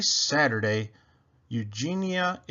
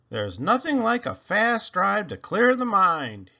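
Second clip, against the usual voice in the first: shouting over wind; 7 semitones higher, 5 dB louder than usual. A narrator's reading. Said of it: "he shouted".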